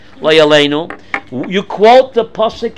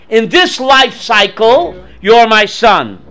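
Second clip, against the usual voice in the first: slow, deliberate stress on "this" and "you're"; slow emphatic; slower than usual. Acted. Rivka explains the life cycle to Yaakov, slowly and deliberately. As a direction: lay it out slowly, one word at a time. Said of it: "She's saying".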